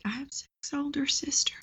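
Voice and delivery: imitating an unconfident client in a high whisper; high, hushed, barely voiced answer; tiny whispery voice. Client answering the coach's sibling question, tiny whispery voice. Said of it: "they would have a voice like this".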